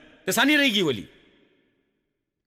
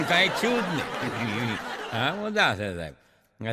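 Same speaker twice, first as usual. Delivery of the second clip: laughing; 9 semitones lower, 5 dB quieter, slower than usual. Real, not acted. Laughing after punchline.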